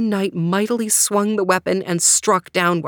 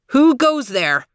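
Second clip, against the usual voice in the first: raised, higher challenging call; calling out aloud; 5 semitones higher, 3 dB louder than usual. Acted. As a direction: call out into the empty valley. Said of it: "said Gawain aloud".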